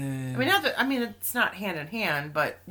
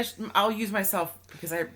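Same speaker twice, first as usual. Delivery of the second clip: ordinary delivery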